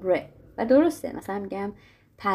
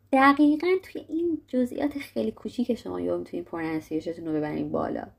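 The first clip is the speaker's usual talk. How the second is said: usual speaking voice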